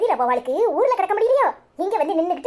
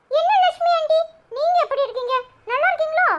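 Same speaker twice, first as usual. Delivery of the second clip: put-on much higher, chirpy small child; little boy voice; 12 semitones higher than usual. Cheeky boy greeting neighbour Lakshmi aunty, high child voice. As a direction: play a cheeky young kid.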